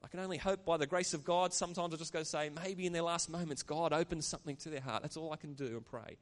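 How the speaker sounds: normal talking voice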